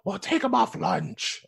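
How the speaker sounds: high, slow, put-on boss; bossy director voice. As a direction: drag out the order. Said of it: "crew quarrel acted out".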